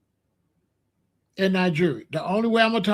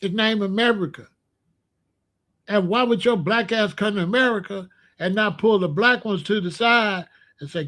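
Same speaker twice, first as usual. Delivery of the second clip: ordinary talk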